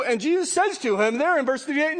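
loud, ringing sermon voice; preaching at full force. Acted. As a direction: drive it home to the congregation. Preaching climax, raised voice.

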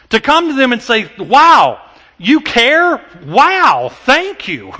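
loud, high, impassioned preaching; full-force preaching. Acted.

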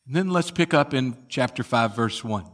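usual speaking voice

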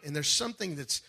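ordinary talk

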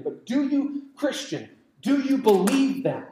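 ordinary delivery

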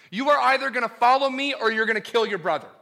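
urgent pulpit challenge; forceful preaching. Acted. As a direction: hammer the either-or home. God's choice to Cain, preached.

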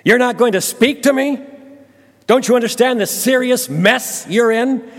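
high, loud, domineering rant; bully him with your power, loud and high; angry interrogation. Acted. Pilate threatens silent Jesus.

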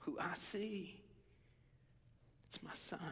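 hushed, half-whispered fatherly pride; tender. Acted. Preacher voices God naming his son, tenderly. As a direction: confide it softly, with love.

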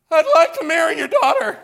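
high, loud squeaky crack, laughing through it; a nervous young man's voice breaks mid-sentence. Retold or imitated. Telling the congregation how his voice cracked, high and laughing. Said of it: "My voice completely cracks".

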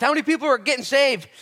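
high, loud shouted question; impassioned preacher's shout; urgent question. Acted. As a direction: call the question out forcefully. Preacher voicing question to Jesus.

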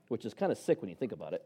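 normal talking voice

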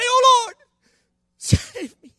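high, loud cry for rescue; desperate shout. Acted. Preacher showing how a sinking Peter really prayed, desperate shout. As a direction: shout it like a drowning man. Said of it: "I'd say".

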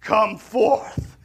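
big booming call to a tomb; booming Jesus calling Lazarus out; deep booming voice. Retold or imitated. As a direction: call him out, loud and ringing. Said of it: "like, deep booming voice".

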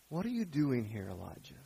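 soft, hushed question trailing away; gentle whisper. Acted. God's gentle whisper asks Elijah why he is there, very soft. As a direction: let God's voice come in a hush. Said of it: "a gentle whisper".

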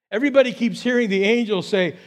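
ordinary talk, nothing marked

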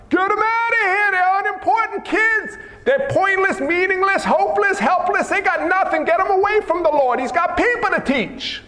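high, hammed-up rant; dismissive disciple. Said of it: "They said like this".

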